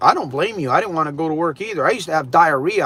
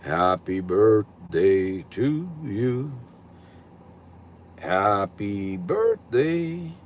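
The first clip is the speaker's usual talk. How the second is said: slow, low, drawn-out radio DJ delivery; lightly put-on smooth announcer voice; 9 semitones lower, 5 dB quieter, much slower than usual. Shown as a demonstration. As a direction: stretch each word like a late-night radio host. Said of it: "Here's my radio voice".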